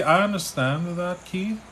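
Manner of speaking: ordinary talk, nothing marked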